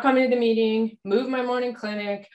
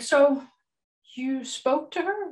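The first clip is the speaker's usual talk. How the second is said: slow, higher-pitched careful question; cleverly probing; 7 semitones higher, slower than usual. Retold or imitated. Doctor discreetly checking on her friend with husband, cleverly probing. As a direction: draw it out, fishing for news. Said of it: "I think I'm being clever".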